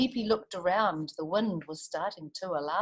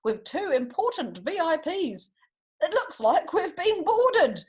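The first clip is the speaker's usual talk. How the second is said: higher, louder, slowing captain's order; 9 semitones higher, 4 dB louder than usual. A narrator's reading. Captain orders crew as birds board ship. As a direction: bark it out with mock alarm. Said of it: "the captain ordered".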